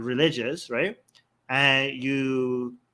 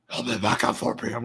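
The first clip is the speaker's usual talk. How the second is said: lightly voiced lower, mannish put-on; fake man's voice; 5 semitones lower than usual. Imitating the veiled woman answering the caller, mock manly. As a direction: drop into a deliberately unsexy mannish tone. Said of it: "Like, she talks like that".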